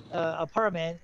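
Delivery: usual speaking voice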